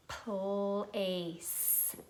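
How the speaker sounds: "Place" stretched slowly sound by sound. Shown as a demonstration. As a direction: draw out each sound in "Place". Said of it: "Let's sound it".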